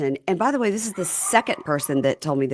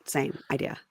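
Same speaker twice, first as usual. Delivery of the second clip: whisper. Real, not acted. Quiet aside on the show.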